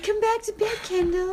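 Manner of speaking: high-pitched put-on lover's voice; mock seductive call. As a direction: coo it as the woman calling from bed. Voicing the woman calling Kendall.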